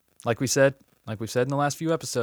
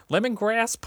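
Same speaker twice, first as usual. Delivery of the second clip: "lemongrass?" asked with a sharp high rise; unsure pronunciation; 10 semitones higher than usual. Shown as a demonstration. Doubting host asks the air whether it's "lemongrass". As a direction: test the word "lemongrass" as a question, pitch jumping up. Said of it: "No, lemongrass".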